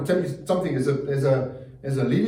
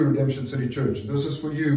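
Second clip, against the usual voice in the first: usual speaking voice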